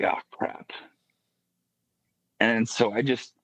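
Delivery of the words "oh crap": breathless whispered dismay; hushed dismayed whisper; whispered oh crap. Retold or imitated. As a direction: whisper it as a private realization. Inner reaction to friend's question.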